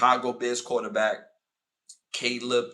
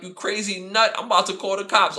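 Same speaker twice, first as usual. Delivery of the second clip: retold yell; 7 semitones higher, 6 dB louder than usual. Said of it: "I'm like".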